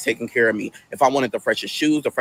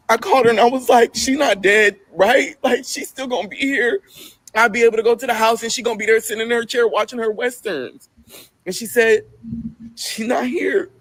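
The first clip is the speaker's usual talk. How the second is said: distraught pleading; 9 semitones higher than usual. Real, not acted. Emotional retelling of grief.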